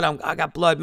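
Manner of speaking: ordinary talk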